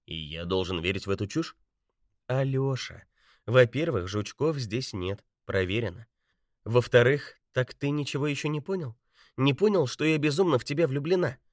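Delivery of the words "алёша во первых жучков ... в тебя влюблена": tearful. A narrator's reading. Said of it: "В глазах Бетти блеснули слёзы".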